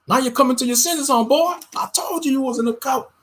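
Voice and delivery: mock him like a smug relative; imitating mockery. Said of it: "They'll start mocking you".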